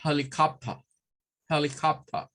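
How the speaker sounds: helicopter in a British accent, no final r, HEL-i-cop-tuh; British accent, drop the r. Shown as a demonstration. Teacher showing how a Brit says it. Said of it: "Un británico nos va a decir".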